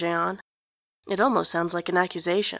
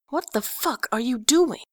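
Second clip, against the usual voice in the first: angry hiss; 4 semitones higher than usual. Acted. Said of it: "Enjolras hisses".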